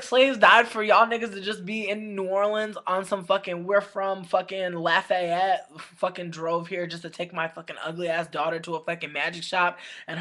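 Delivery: loud at first, fading back to normal; angry rant. Retold or imitated. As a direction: rant at the customer, hot then cooling. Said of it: "I was like".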